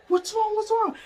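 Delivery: high-pitched urgent question; retold alarmed asking. Coworkers asking her what happened, high and urgent. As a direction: imitate people asking in alarm.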